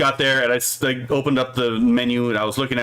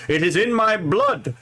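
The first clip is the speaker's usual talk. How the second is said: higher, slow, hammed-up proclamation; lightly mock-pompous boast; 6 semitones higher than usual. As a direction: declare it like a tongue-in-cheek confession. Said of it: "I'm gonna become a racist against myself for a moment".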